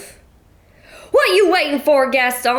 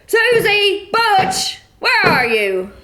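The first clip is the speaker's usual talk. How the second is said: calling out; 10 semitones higher, slower than usual. Acted. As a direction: shout for them from the stairs. Mother calling family from stairs.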